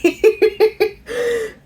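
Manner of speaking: high-pitched giggle fit; giddy high giggle; high laugh. Real, not acted. Laughing at herself.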